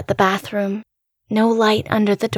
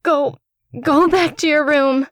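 choked, strained and higher; 6 semitones higher than usual. A narrator's reading. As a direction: force out the order through tears. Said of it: "I choked out".

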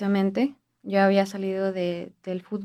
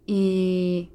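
ordinary talk

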